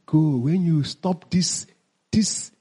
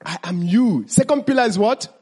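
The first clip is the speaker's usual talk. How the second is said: normal talking voice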